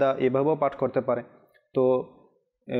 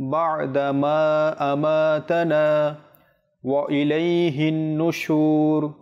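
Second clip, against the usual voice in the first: slow, drawn-out chanted recitation of the dua; slow melodic Arabic recitation; 2 semitones higher, much slower than usual. Shown as a demonstration. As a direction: chant each phrase slowly, stretching the vowels.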